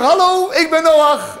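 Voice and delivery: high, drawn-out hail to a swimmer; calling out. Acted. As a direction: call across the water, stretched and high. Noah calls to swimming ape. (van ver roepend, hoog en gerekt)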